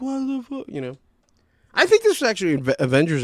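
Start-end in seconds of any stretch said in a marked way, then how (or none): 0.0-0.7 high-pitched mock outrage; imitating bugging out. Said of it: "Bugging out".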